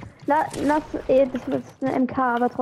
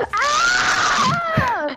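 shocked cry. Real, not acted. Caught by Jessica, cries out.

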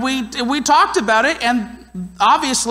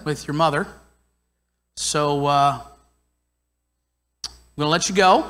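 lower, unbothered dad brushing it off; lightly voiced easygoing father, mouth on his pizza; dad eating pizza answers the upset son, lower and relaxed; calm dad; 4 semitones lower than usual. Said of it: "And he went".

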